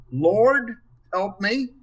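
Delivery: "Lord" punched loud and high, then "help me" lighter; stress on Lord. Shown as a demonstration. Demonstrating emphasis to a Bible study panel, stress on Lord. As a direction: lean hard on "Lord" as the plea. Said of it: "Where do you put the emphasis?".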